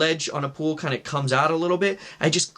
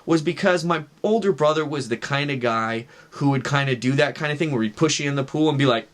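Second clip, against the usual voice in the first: plain reading voice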